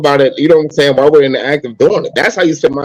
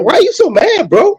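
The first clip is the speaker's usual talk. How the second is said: mock high squeal; 13 semitones higher than usual. Mocking angry men.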